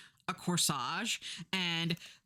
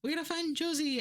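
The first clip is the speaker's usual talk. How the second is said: higher, breathless news alarm; higher mock newscaster panic; frantic news; 9 semitones higher than usual. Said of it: "all the news is like".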